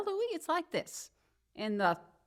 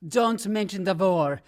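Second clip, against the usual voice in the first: sarcastic apology; 8 dB louder than usual. Acted. Mock apology in a quarrel.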